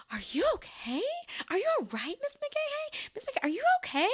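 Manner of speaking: put-on high, little-kid voices asking; worried kindergartners. Kindergartners checking on their slipping teacher, high and worried. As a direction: voice the concerned little kids. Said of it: "my kindergartners were like".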